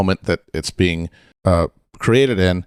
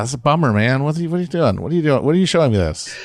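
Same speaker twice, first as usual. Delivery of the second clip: mock protest, pitched up; higher, incredulous griping in imitation; complaining moviegoer; 6 semitones higher, much faster than usual. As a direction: play the let-down viewer whining at the filmmaker.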